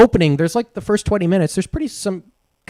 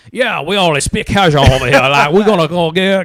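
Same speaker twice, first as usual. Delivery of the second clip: a jokey rural Cajun accent, played big and loud; mock Cajun accent; 7 dB louder than usual. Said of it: "like, that accent".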